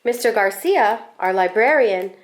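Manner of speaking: usual speaking voice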